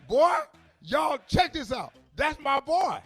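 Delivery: high, loud, delighted bragging; proud daddy. Retold or imitated. Father bragging to his boys at the gas station, high and loud. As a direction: show the boy off to the men.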